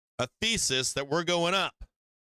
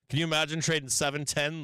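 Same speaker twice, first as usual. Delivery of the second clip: ordinary talk, nothing marked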